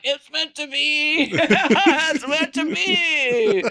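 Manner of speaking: high, long drawn-out wail with laughter; mock-sick insistence. Teller re-enacts herself sick and set on the club, mock-delirious wail. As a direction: draw every word out high, playfully delirious. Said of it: "And I'm all sick. I'm like".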